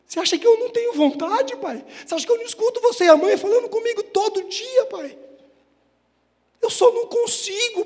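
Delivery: put-on high, loud, anguished outburst of a boy to his dad; imitating crying child. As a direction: plead as a tearful son, building in desperation. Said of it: "ele chorava". (imitando criança em choro de desabafo)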